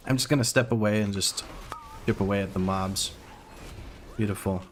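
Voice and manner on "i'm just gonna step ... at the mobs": ordinary talk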